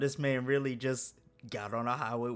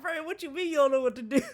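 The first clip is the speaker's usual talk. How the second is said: high, loud, fast retort between laughs; laughing incredulity; 13 semitones higher, 8 dB louder, faster than usual. Retold or imitated. Worker to clueless friend on the phone, loud laughing disbelief. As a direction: throw the question back, cracking up.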